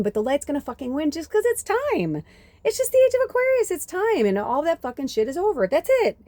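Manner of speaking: high, rising fervent outburst; fired up. Real, not acted. Speaker rallying listeners that the light will win, fired up. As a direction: build to a high, fast, defiant insistence. Rebuts doom talk with rising fervour.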